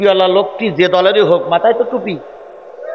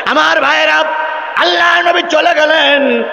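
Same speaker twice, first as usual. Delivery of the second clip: impassioned preaching; 11 semitones higher than usual. Acted. Preaching at full force.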